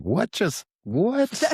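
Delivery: imitating her own baffled double take; stunned disbelief. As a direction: squeak it out in mock astonishment. Baffled at coming back full circle.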